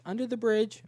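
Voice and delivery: put-on high, offhand girl's voice; mock girl answering from the bridge; girl's reply. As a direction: toss it off high and casual. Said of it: "What are you doing?".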